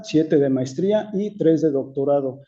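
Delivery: ordinary talk, nothing marked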